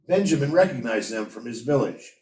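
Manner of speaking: ordinary delivery, nothing marked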